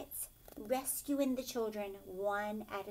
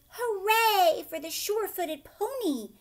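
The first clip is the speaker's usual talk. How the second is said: higher, louder happy cheer; 10 semitones higher, 10 dB louder than usual. Acted. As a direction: ladies cheering for the pony. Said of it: "The lovely ladies cried".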